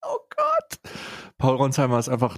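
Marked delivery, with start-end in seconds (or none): 0.0-0.8 squeaked much higher; high oh god. Real, not acted. Host reacting to the memory, high squeak. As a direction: shriek of disbelief.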